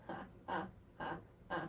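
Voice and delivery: soft breathy creepy laugh; mock sinister giggle; dark corner laugh. Shown as a demonstration. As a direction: chuckle to yourself in the dark. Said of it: "laughing to myself".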